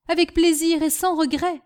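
high, mildly heated retort; defiant. Acted. Fired servant answering her master, defiant. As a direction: throw the dismissal straight back. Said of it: "mademoiselle Rose s'emporta".

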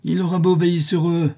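high-pitched, fierce cry; ferocious exaltation. A narrator's reading. Widow defying her son, ferocious exaltation. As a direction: exult savagely. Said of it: "avec une exaltation féroce".

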